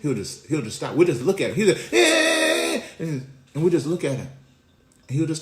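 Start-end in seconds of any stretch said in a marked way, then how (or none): 1.9-2.8 very high, loud whining wail; mock baby cry. Dad imitating his toddler crying for attention, high mock wail. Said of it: "he'll be crying".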